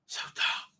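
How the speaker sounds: whispered and weary. Real, not acted. Host deflated by the plot, whispered to himself. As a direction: sigh the words out under your breath. Muttering after a sigh.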